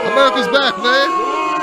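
high, loud mocking repeat; mocking shout. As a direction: throw the line back, incredulous. Mocking the pundit's line.

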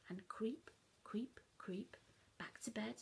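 soft creeping whisper; sneaky whisper. A narrator's reading. Child sneaking back upstairs, whispering. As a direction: tiptoe with the voice.

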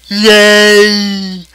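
high, long held squeal of joy; drawn-out celebratory cry; gleeful cheer. Real, not acted. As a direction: cheer on one sustained high note. Cheering reaching fast money round.

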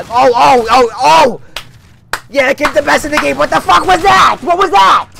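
high, loud yelling in disbelief; outraged yell. Real, not acted. Dying to the best player in the lobby, a real outraged yell. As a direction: blow up at the screen after a cheap death.

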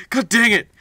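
loud annoyed outburst; frustrated shout. Real, not acted. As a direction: blurt it out in real frustration. Frustrated at game keys.

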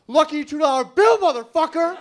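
very loud, high-pitched gloating shout; triumphant yell. Retold or imitated. Friend to him after robber walks away, triumphant yell. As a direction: yell it in triumph, rising at the end.

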